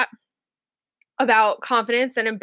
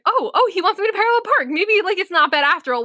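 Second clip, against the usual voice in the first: hopeful; 8 semitones higher than usual. Retold or imitated. Hopeful relief, quoted self.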